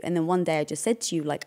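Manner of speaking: ordinary talk, nothing marked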